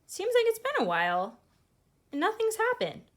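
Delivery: lightly voiced impatience; impatient waiting. Teacher voices Rocket waiting for meteors, mildly impatient. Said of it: "she's like".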